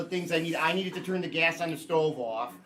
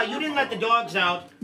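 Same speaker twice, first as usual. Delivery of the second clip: ordinary delivery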